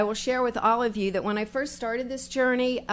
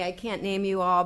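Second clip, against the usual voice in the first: ordinary delivery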